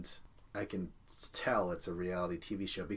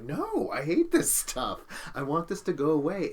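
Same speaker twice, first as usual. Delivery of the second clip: whiny raised-voice refusal; exasperated outburst; 7 semitones higher, 6 dB louder than usual. Retold or imitated. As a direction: retell it as a loud, pleading complaint. Acts out own exasperated reply.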